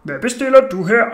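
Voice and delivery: loud mock grown-up questioning; put-on man's voice. A narrator's reading. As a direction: question the small boy loudly as a grown man. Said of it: "sagde jeg med den dybeste stemme".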